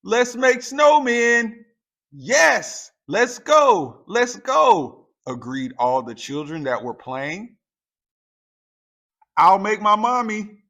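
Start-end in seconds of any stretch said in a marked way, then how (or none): 0.0-5.1 high, loud, joyful shouting; excited child's cry. A narrator's reading. Children calling each other out to play, excited shout. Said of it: "Someone cried". 9.4-10.6 put-on excited child. A narrator's reading. Child voiced in story.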